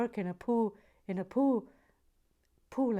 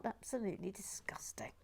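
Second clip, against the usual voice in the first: whispered mock outrage; 10 dB quieter than usual. Real, not acted.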